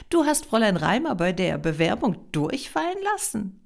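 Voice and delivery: ordinary delivery, nothing marked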